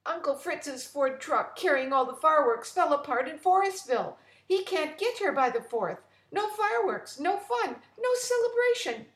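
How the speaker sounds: high and loud, near tears; a wail over the lost fireworks; upset. Acted. Frida explains to the chief, high and distraught.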